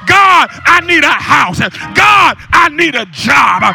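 loud preacher climbing into high, sustained shouts; fervent shouted preaching, key words drawn out; full-force preaching. Real, not acted. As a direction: cry out to God and rouse the congregation.

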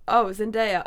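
ordinary talk

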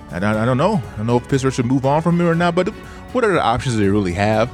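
ordinary talk

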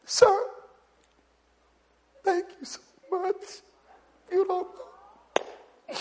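high, teary and choked up, slowing at the end; grateful waitress thanking the pastor, crying high; tearful waitress. Retold or imitated. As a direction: thank him through tears in a high voice. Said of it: "and she came out".

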